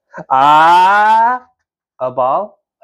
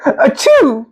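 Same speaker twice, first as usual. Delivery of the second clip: big mock sneeze; 9 semitones higher than usual. Character sneezing.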